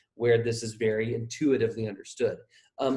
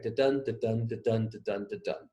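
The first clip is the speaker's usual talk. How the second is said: "Da-dun" repeated in an even beat, dun louder than da; weak da, strong dun, steady "Da-dun" rhythm; iambic stress; 4 dB quieter than usual. Shown as a demonstration. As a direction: chant "Da-dun" with the weight on each second syllable. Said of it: "every other syllable is louder".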